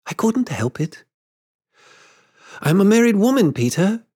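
high-pitched, halting confession; gentle woman's high voice, with hesitant pauses; grown Wendy. A narrator's reading. Wendy confesses she has grown up.

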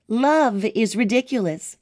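usual speaking voice

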